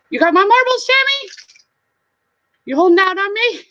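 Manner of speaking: loud, very high goofy demand; high-pitched playful interrogation; mock accusation. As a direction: accuse a pal in a silly high voice.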